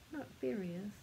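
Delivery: ordinary delivery, nothing marked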